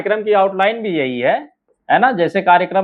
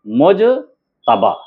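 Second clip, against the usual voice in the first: slow मुजतबा with a long gap before तबा; drawn-out मुज... तबा for writing along; syllable by syllable; 3 semitones lower, much slower than usual. Shown as a demonstration. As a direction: say मुजतबा in two separate pieces. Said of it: "क्या हो गया?".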